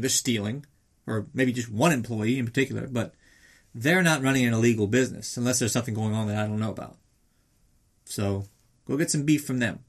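usual speaking voice

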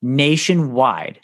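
"nationwide" said slowly, every syllable drawn out; slow, deliberate "nationwide" with a falling close; stress on nationwide. Shown as a demonstration. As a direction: stretch "nationwide" out for emphasis. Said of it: "I also wanna emphasize the, the next word".

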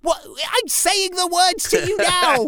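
high, slow incredulous scolding; mock exasperated dad; exasperated disbelief. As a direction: spell it out in disbelief. Exasperated parent voiced.

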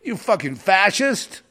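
loud, high-pitched angry movie shout; imitated full-force bellow, slowing on the last insult; Malibu sheriff. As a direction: yell it like a cop throwing someone out. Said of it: "with the Malibu sheriff".

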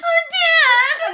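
high loud crying call; mock tearful cry. Kid running to his dad, hammed-up crying shout. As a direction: wail it like a kid overcome.

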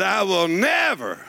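slow, swelling sermon cry; preaching at full force. Acted. Preacher proclaiming the promise to the congregation, slow and rising.

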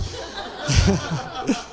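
a soft, airless chuckle at his own joke; breathy laugh. Real, not acted. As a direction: let a quiet laugh slip out. Laughs at own ransom-note joke.

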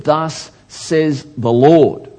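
loud, high, drawn-out proclamation; prophetic declaration. Acted. Prophet Jeremiah addressing the king, slow loud proclamation. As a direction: proclaim it slowly and forcefully, word by word. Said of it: "When Jeremiah came before the king and he said".

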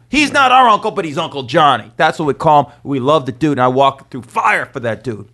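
normal talking voice

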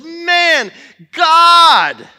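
lightly voiced Jethro, a big amazed "God"; awed Jethro. Jethro hearing what God did, a hint of loud wonder. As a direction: let the awe burst out on "God". Jethro reacts to Moses' story.

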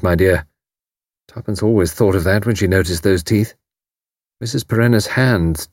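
ordinary delivery

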